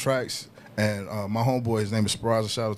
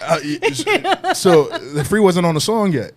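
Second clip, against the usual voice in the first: laughing; 7 semitones higher, 6 dB louder, slower than usual. Real, not acted. Laughing recalling studio moment.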